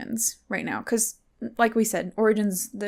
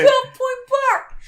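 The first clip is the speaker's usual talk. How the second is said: very high, slow, loud Kentucky holler; hammed-up backwoods Kentucky shout; exaggerated Kentucky accent; 6 dB louder than usual. Shown as a demonstration. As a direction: stretch it out as a mock Kentucky yell. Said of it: "This is in Kentucky. We don't sound like".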